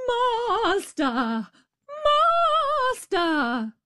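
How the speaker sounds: high ringing cry; harp crying out. A narrator's reading. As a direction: shout for the master. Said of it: "the harp cried out".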